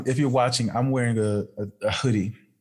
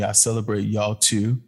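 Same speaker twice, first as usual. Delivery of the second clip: ordinary talk, nothing marked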